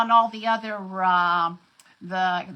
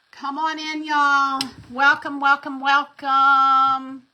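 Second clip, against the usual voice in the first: louder, higher calls of welcome; loud cheery welcome; 4 semitones higher, 6 dB louder than usual. Real, not acted. Host greeting livestream viewers, loud cheery call. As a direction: sing out the welcome to the room.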